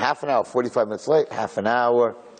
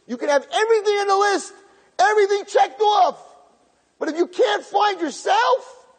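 much higher, a shout held at the peak; preaching climax. Acted. Preacher hammering the moral to his listeners, shouting high. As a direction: drive the lesson home at full force.

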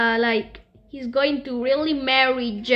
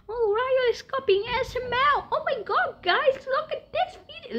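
very high, squeaky mocking imitation; high-pitched mock outrage; whiny hater; 13 semitones higher than usual. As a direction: mimic a tattling hater in falsetto. Mimics hater accusing him of copying.

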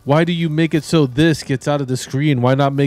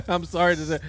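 high voice breaking into giggles; real laugh, softening at the end; 6 semitones higher than usual. Real, not acted. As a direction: crack up and apologize through the laugh. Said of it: "you know why I'm laughing".

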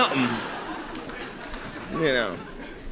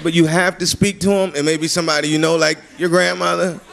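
usual speaking voice

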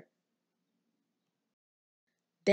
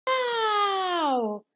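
high delighted wow; a child wowed by a giant bubble; high amazed child's wow; 10 semitones higher than usual. A narrator's reading. Chip amazed by huge bubble.

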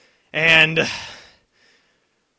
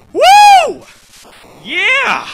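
huge triumphant shouts, much higher and louder; victory shout; 13 dB louder than usual. Real, not acted. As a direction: scream with joy after beating the boss. Celebrating the win.

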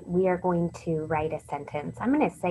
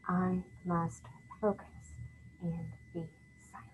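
word by word; 10 dB quieter than usual. Shown as a demonstration. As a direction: separate each word, almost under your breath. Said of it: "I'm gonna tap on the board".

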